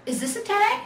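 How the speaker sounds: thrilled at the discovery; excitedly. A narrator's reading. Little bear finds his tag, excited and high. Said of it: "he asked excitedly".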